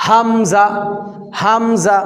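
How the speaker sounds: হামজা caught at the start of the throat; Arabic letter hamza. Shown as a demonstration. Teacher showing hamza from the throat. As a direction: glottal stop on হামজা. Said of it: "খেয়াল করেন, এই যে এটা".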